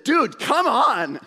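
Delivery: high, drawn-out exasperated cry of "Dude, come on!"; annoyed outburst. Retold or imitated. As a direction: let the irritation spill out at the driver. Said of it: "not such a friendly, that was the anger".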